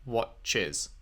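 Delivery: "Cloaked desire watches" drawn out very slowly; slow reread. Shown as a demonstration. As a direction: stretch each word of "Cloaked desire watches". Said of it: "Is that a haiku?".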